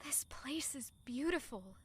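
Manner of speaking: breathless whisper of wonder; soft hushed awe; awed whisper. Acted. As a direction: whisper it, taken aback by the beauty. Awed at the garden district.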